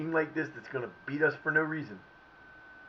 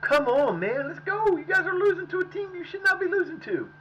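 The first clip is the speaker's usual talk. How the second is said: much higher, louder scolding; frustrated yelling; 14 semitones higher, 4 dB louder than usual. Real, not acted. Gamer berates his players for losing, high frustrated yelling. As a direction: push them hard, fed up.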